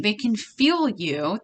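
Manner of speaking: slow, each word set apart; slow, pointed. Real, not acted. Reader insisting to the feminines that masculines sense their anger, slow and pointed. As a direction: spell it out slowly so it sinks in. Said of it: "what did I just say?".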